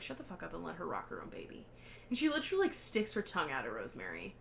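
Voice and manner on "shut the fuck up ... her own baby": hushed snap. Retold or imitated. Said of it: "Roman's like".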